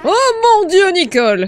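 stunned exclamation on seeing Nicole alive; shocked cry. Real, not acted. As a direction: blurt it out in shocked disbelief. Sees his lost girlfriend alive.

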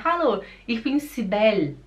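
"Cibele" ending on the L; near-silent final E. Shown as a demonstration. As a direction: say "Cibele" as si-BEL, dropping the last vowel. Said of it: "o E quase que ele não se, não se escuta".

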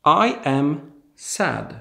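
slow, carefully spaced words for a class; slow model sentence. Shown as a demonstration. Teacher to Polish students, slow clear model sentence. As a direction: say it slowly so learners can copy. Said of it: "Jak powiedzieć, ja jestem smutny?".

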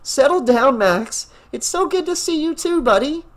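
high, light woman's voice, excited to see her dog; young woman voice. Acted. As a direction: voice Rachel, happy and relieved. Narrator voicing Rachel.

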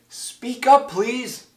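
high, loud call, easing off on the last word. A narrator's reading. Horton asks the faint speck voice to speak up, calling out.

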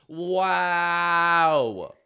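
long, loud, held exclamation; drawn-out wow. Real, not acted. Gamer reacting to the game, drawn-out wow. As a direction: stretch it out loudly on a held note.

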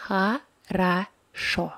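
хорошо read slowly as ha-ra-SHO; хорошо with unstressed O as A, last O full; syllable-by-syllable model. Shown as a demonstration. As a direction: break хорошо into three slow syllables. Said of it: "So we read it as".

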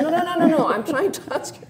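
high, loud, laughing no-no-no; half laughing, trying to get through; exasperated protest. Retold or imitated. Telling the clerk she is only asking, exasperated and laughing.

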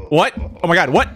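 normal talking voice

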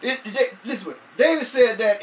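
ordinary talk, nothing marked

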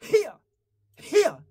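high sharp 히야 struck then dropped; punchy demo cry; sharp vocal accent. Shown as a demonstration. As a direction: hit the note and fall off it. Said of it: "이런 것처럼 찍고 내려오는 임팩트".

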